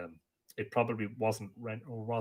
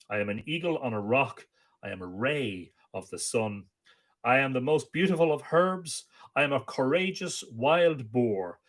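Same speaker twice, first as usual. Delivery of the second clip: poem recitation; 4 semitones higher, 5 dB louder than usual. Acted. Amergin landing on Ireland proclaims his song, declaimed. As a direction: proclaim each line like an ancient bard.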